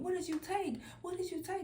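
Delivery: high, urgent repeated question; insistent questioning. Retold or imitated. Mama questioning her child over and over, insistent. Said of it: "kept saying".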